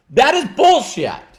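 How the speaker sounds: furious, deliberate condemnation; emphatic anger. Real, not acted. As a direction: slam the excuse down hard. Angry rejection, swearing.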